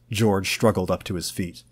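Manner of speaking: ordinary delivery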